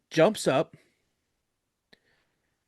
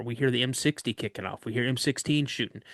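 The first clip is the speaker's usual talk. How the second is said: normal talking voice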